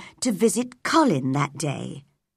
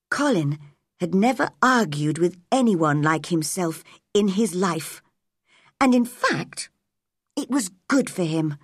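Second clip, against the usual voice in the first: ordinary delivery, nothing marked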